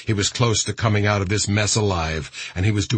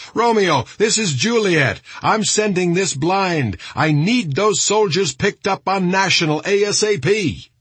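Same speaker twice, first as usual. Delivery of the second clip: high, raised radio call; urgent commander on radio; 10 semitones higher, 3 dB louder than usual. A narrator's reading. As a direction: send the order out urgently, pitched way up.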